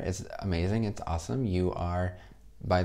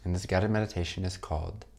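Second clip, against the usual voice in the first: calm meditation voice with long pauses; guided meditation. Acted. Teacher closing the course for students, guided meditation. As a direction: lead them gently into rest, leaving space between phrases.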